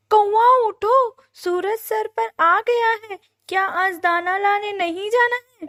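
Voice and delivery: high, loud wake-up call from a bird; scolding neighbour bird. A narrator's reading. Neighbour bird rousing the sleeping crow, scolding. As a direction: chide a lazy sleeper, pitched high and loud.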